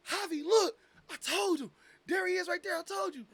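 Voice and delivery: high, loud excited call to a friend. Retold or imitated. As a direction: point him out, thrilled. Calling friend, retold excitement.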